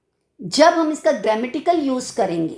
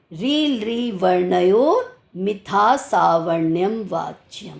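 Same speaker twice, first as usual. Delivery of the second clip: sutra recitation; 4 semitones lower, slower than usual. Acted.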